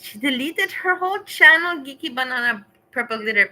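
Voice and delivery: high, loud dismayed outcry; shocked. Real, not acted. Streamer to chat, dismayed and shocked. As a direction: announce it like a disaster.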